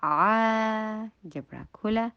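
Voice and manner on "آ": آ drawn out, higher and louder; long recited آ; held open vowel. Shown as a demonstration. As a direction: open the jaw and hold آ. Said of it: "تھوڑا سا time لگائیں گے".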